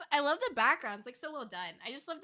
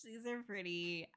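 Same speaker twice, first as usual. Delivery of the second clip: ordinary talk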